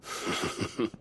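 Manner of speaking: soft breathy laugh. Acted. Villain mocks the hero.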